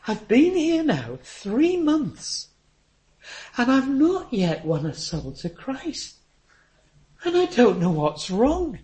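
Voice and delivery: high, slow, fragile plea; frail old woman. Retold or imitated. Quoting a dying old missionary.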